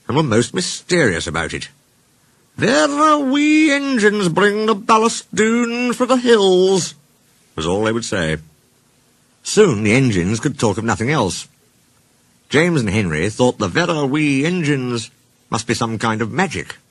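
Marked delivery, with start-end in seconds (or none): 2.6-6.9 high, slow singing Scottish brogue; Scottish accent. Acted. Imitating Douglas and Donald's Scots speech. 13.7-15.1 slow, higher mock Scottish brogue; Scottish accent. Quoting the Scottish engines' phrase.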